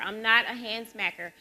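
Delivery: usual speaking voice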